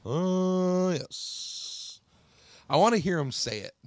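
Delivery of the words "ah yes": pompous "Ah" sliding into a breathy "yes"; teacher's "Ah, yes". Retold or imitated. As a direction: imitate the old teacher's catchphrase. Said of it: "It was".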